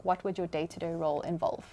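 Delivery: ordinary delivery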